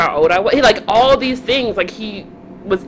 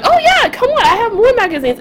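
high, bubbly invitation; eager young self. Retold or imitated. Calling strangers over for more magazines, brightly eager. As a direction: mimic an innocent kid cheerfully offering. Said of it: "I remember just being like".

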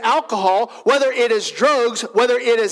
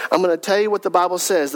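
ordinary delivery

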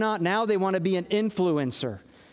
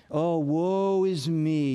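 mock self-pity; 3 semitones lower, slower than usual. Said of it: "hear him describing the self-pity".

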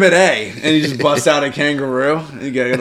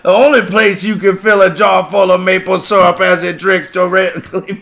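put-on a bayou judge scolding a Yankee, thick accent; thick Cajun accent. Judge scolding the defendant, thick Cajun accent. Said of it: "the congressman and the judge are both hard accents".

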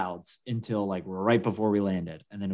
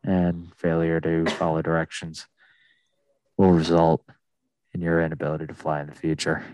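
a lightly voiced, lower captain reading the rules; airline pilot; 6 semitones lower than usual. Still mocking the pilot, lightly voiced lower captain. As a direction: drone through the cabin announcement. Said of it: "talk like".

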